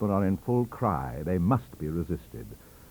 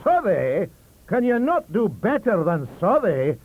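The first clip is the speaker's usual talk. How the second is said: loud Scots sneer; mock the choice of Southey; scornful; 12 semitones higher, 8 dB louder than usual. Librarian sneering at Le Pan, loud. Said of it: "Caledonian contempt".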